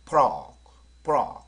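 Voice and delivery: 프로 launched with a popping burst into a pulled-back r; loud, punched 프로 as one English-style syllable; bursting onset. Shown as a demonstration. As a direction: burst into the first sound of 프로, tongue drawn back for the r. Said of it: "약간 터지면서".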